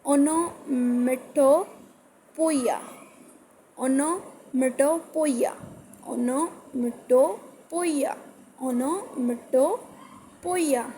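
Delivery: onomatopoeia drawn out very slowly, syllable by syllable; slow pronunciation drill. Shown as a demonstration. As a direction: model onomatopoeia slowly for students to copy. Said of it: "वो इस तरह नहीं बोल सकते".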